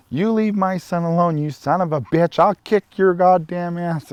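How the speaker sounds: high, loud furious threat; mom yelling. Retold or imitated. Mother in wheelchair shouts down her son's harasser, furious and loud. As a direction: bark it at him like a fierce mother protecting her son.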